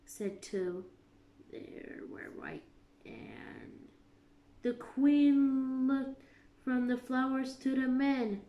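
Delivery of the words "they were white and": low, whispered, scared. A narrator's reading. Gardener confesses to the Queen, whispering. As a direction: barely breathe it.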